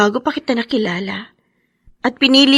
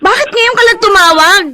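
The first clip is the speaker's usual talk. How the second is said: high and loud, hurt and furious; tearful outburst; 9 semitones higher, 8 dB louder than usual. Acted. Wife confronts her husband over his late call, loud and tearful. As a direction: let a week of waiting burst out.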